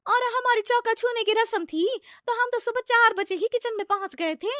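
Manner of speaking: high, loud show-off; shrill young bride boasting. Acted. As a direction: brag in a high voice. Daughter-in-law boasts.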